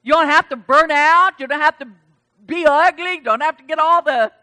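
loud, ringing preacher's call; full-force preaching. Acted. Pastor exhorting the congregation, loud and high. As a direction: preach it out to the whole room.

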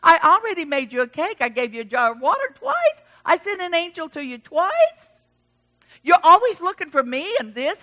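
fast, loud acted rebuke; God fed up with a prophet; exasperated scolding. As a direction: rattle off grievances in mock exasperation. Acts out God scolding Elijah.

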